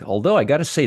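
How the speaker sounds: ordinary talk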